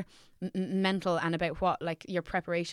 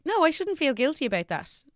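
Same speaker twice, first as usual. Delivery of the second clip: higher, insistent self-reassurance; pitched-up quoted inner voice; high self-talk quote; 9 semitones higher, 6 dB louder than usual. Retold or imitated. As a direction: tell yourself firmly, pitched up. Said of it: "I've tried to be like".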